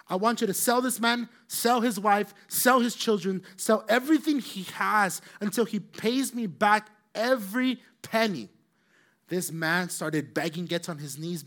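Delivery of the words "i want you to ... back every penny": loud, commanding royal decree; the king orders the servant sold, loud and angry; stern king's order. Acted. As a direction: bark the orders like a furious king. King demanding his money back.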